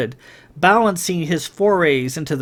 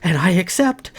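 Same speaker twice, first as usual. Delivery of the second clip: joyful acceptance; 5 semitones higher than usual. A narrator's reading.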